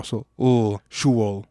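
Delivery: "ul" and "shuol" with a dark, back vowel; dark vowel demo. Shown as a demonstration. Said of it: "has the dark au".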